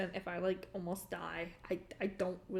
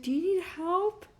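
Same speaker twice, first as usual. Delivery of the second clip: much higher, louder mock-frantic guard; panicked guard; 10 semitones higher, 7 dB louder than usual. Quoting the freaked-out security guard offering help, mock frantic. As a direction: play the guard in a frantic fluster.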